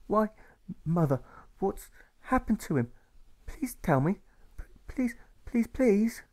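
put-on a pleading little boy; stuttering boy. A narrator's reading. Alfred begs his mother for news, stammering.

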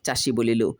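plain reading voice

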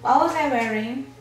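ordinary talk, nothing marked

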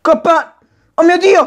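loud, high excited shout. Real, not acted. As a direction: yell it out in excitement. Shouting at game event.

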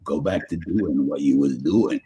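put-on son's Maine accent. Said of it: "he'll just say".

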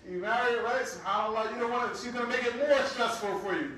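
ordinary talk

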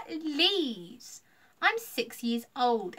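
put-on loud, drawn-out scoffing little kid; haughty, stretched-out scorn; dismissive. A narrator's reading. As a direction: brush them off like a cocky child. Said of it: "Ella said with a dismissive wave of her hand".

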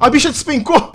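high-pitched, amused through laughter; laughing. Real, not acted. Gamer seeing the enemy plummet, laughing. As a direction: laugh while saying it.

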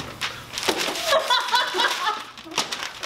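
laughing. Real, not acted. Pie just hit the face.

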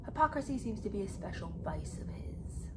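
a sly, hushed jab at the author; sarcastic whisper. Real, not acted. Viewers hear her whisper a snide aside about Harry. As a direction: whisper the dig under your breath.

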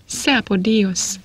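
ordinary delivery

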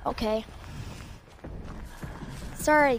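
ordinary talk

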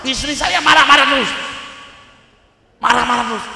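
high, loud mock-distressed wail; loud complaint. Husband complaining to the kiai about his wife, loudly. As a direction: blurt it out at high volume.

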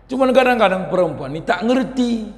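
normal talking voice

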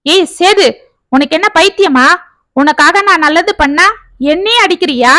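wife shrieking at her husband in fury; furious shout. Acted. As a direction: scream back at him after the slap. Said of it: "ஆவேசமாக கத்தினாள்".

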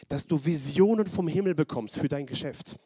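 ordinary talk, nothing marked

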